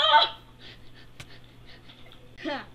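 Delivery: ordinary delivery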